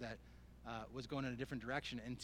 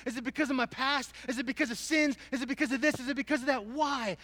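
mad at God; 9 semitones higher, 11 dB louder than usual. Retold or imitated. Preacher reliving his questioning of God, mad and building to shouts. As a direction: erupt from a near murmur into pleading shouts. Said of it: "I'm, I'm, I'm mad".

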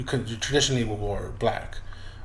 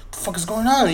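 getting scared; 9 semitones higher, 4 dB louder than usual. Retold or imitated. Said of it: "I start getting a little scared".

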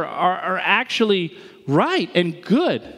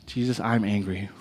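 much lower and softer, a breathy-free quiet confession; hushed prayer; 9 semitones lower, 6 dB quieter than usual. Shown as a demonstration. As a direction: say it like a private prayer.

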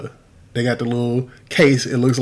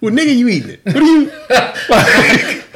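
high, loud and laughing; laughing retort; 11 semitones higher, 6 dB louder than usual. Real, not acted. Roasting an imagined excuse-maker, laughing loudly. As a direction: burst out laughing mid-sentence.

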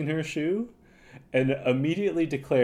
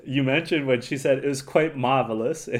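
usual speaking voice

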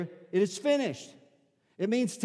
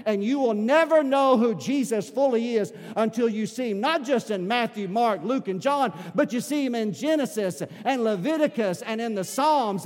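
rising preacher's call, louder; preaching; 3 semitones higher, 4 dB louder than usual. Acted. Pastor rousing the church, full preaching voice. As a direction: drive it home to the congregation.

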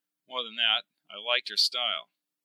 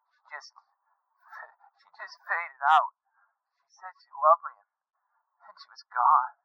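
high, halting grief; anguished radio voice; distraught; 4 semitones higher than usual. Acted. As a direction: choke out how she died, pitched up. Mark recounts her death, distraught.

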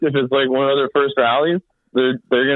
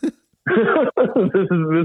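laughing; 6 semitones higher, much slower than usual. Real, not acted. Joking about Seattle weather.

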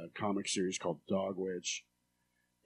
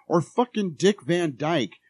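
higher, louder, slow outraged rant; a slow, much louder and higher mock-furious outburst; Kirby rant; 7 semitones higher, 10 dB louder, slower than usual. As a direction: blow up like Kirby would. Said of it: "I just turned into Kirby".

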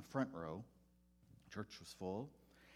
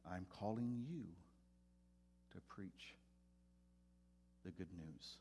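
quiet, tender, unhurried call, much softer; soft loving voice of God; 3 semitones lower, 8 dB quieter than usual. Retold or imitated. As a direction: speak it like a gentle voice from within. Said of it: "just very soft, very loving".

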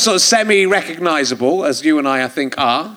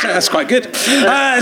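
ordinary delivery

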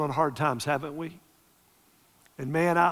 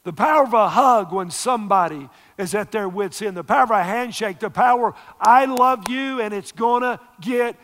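loud, high impassioned preaching; preaching at full force; 5 semitones higher, 5 dB louder than usual. Real, not acted. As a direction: build to a fiery sermon climax. Preaching climax.

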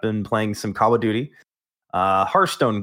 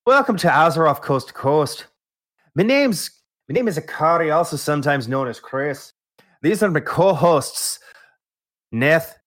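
mock-Scottish brogue that veers toward Irish; hammed-up Scottish accent. Host opens the show posing as co-host Chris, hammed-up Scottish accent. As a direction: play a Scot who sounds half leprechaun. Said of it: "That was a Scottish accent".

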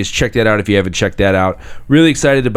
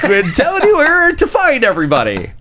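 mock radio DJ sign-off, much higher; old radio voice. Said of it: "the old radio voice".

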